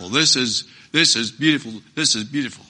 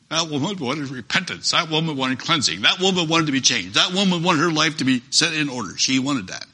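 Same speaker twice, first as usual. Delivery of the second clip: normal talking voice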